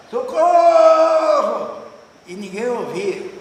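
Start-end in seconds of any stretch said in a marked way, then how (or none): 0.1-1.8 long drawn-out shout, high and loud; shouting for help. Retold or imitated. Lost singer calling for help in the forest, drawn-out shout. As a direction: yell it slowly across the jungle. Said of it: "Ele gritava lá".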